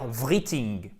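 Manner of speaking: "writing" as VREE-ting, French-style i; mock learner error, "writing" read letter by letter as vree-ting; worse mispronunciation. Shown as a demonstration. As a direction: say "writing" wrongly with VR and ee. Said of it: "ou encore pire".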